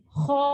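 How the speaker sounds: thick "kho" with lips a little forward; heavy, half-rounded vowel in "kho"; slightly forward lips. Shown as a demonstration. Teacher contrasting with thin letters, forward-lipped thick sound. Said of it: "agak maju".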